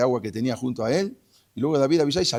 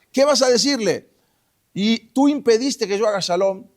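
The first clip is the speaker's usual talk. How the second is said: high, mostly loud confrontation; accusing challenge; 8 semitones higher, 5 dB louder than usual. Retold or imitated. David taunts Abner over his excuses, loud and accusing. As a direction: throw his old excuses back at him.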